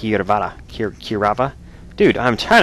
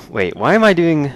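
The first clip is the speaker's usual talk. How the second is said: ordinary talk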